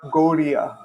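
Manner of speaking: "Gaudiya" with an R-like middle; "Gaudiya" as if written without D; flapped Gaudiya. Shown as a demonstration. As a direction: soften the D of "Gaudiya" into a flap. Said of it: "They won't write a D".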